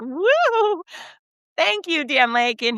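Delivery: high delighted whoop of thanks; squealing thank-you for a cold drink; excited thanks. Retold or imitated. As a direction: whoop with joy at the gift. Thrilled at gift of Diet Coke.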